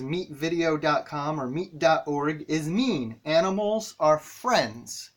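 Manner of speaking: slow, careful reading aloud. Shown as a demonstration. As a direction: read each word slowly for a child. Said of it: "the sentence says".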